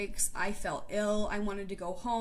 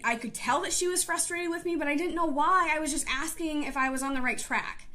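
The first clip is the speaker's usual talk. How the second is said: ordinary talk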